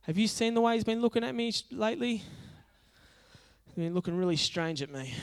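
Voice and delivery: mock-worried, high-pitched excuse; uneasy Judas. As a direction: play a reluctant disciple dodging the job. Acted Judas nervously declines.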